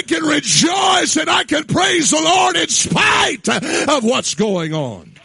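loud, high, shouted sermon cadence; full-force preaching. Acted. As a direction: preach it out to the whole room. Preacher retelling David, building.

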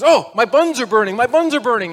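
loud, high comic cry of alarm; panicked mother. Acted. Mother cooking dinner cries out as it burns, panicked. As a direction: yelp in mock kitchen panic.

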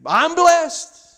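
high, loud fake-cheerful churchy reply; mock chirpy "I'm blessed". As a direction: chirp it out like forced good cheer.

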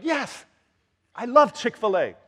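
high, loud, gleeful outburst; mock an overjoyed birthday kid; delighted cheer. Acts out delighted child at birthday.

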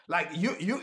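ordinary talk

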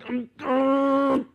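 high, strained closed-mouth hum; stifled outburst. Retold or imitated. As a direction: hold the rage in behind closed lips. Holding back his anger.

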